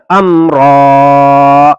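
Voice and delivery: final vowel held one alif long; stretched ending. Shown as a demonstration. Teacher to pupils, stretching the final vowel. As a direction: draw out امرا slowly, long final a. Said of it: "ایک الف کے برابر کھینچ کر".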